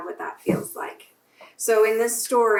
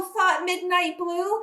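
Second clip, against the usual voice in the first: put-on gentle storybook reading for children; bedtime-story narrator voice; story narration; 5 semitones higher than usual. A narrator's reading. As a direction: read it like a picture book to kids at church. Reading a picture book aloud to children.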